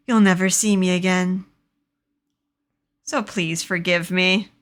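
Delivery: low-key, regretful pleading; remorseful plea. A narrator's reading. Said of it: "in deep remorse".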